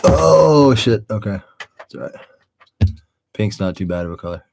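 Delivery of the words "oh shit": much louder, higher sudden yelp of surprise; startled. Real, not acted. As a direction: caught off guard, blurt it out. Startled by something on camera.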